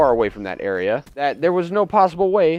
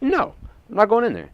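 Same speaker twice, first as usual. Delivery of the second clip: indignant refusal; 9 semitones higher than usual. Retold or imitated. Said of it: "I said".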